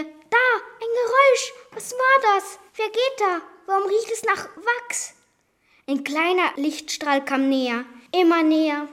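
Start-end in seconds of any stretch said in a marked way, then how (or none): 0.0-5.1 high, startled and fearful; anxious thoughts. Acted. Girl hearing a noise in the dark villa, high and fearful. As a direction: jump at every noise. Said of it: "Ängstlich dachte ich mir".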